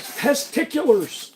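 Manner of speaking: high, slow goofy voice on "Testiculars"; silly drawn-out word. Pretend. Streamer answering a chat joke in a high, slow goofy voice. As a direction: stretch it out like a cartoon.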